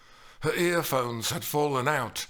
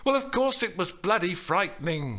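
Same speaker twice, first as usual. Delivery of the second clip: high, cutting indignant retort; raised, scornful snap; scathing; 7 semitones higher, 3 dB louder than usual. A narrator's reading. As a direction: throw it back with scorn. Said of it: "she answered scathingly".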